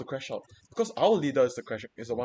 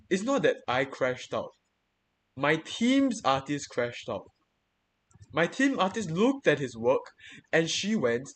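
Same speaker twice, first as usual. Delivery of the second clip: normal talking voice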